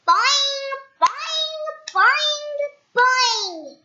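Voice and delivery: high, loud bouncy boings; spring each boing up high; high loud cartoon boings. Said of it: "said Mister Bounce".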